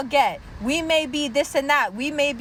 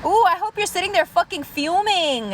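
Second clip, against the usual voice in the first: ordinary talk, nothing marked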